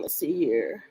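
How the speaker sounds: ordinary delivery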